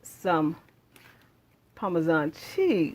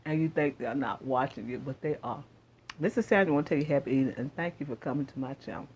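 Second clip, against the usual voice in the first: ordinary talk, nothing marked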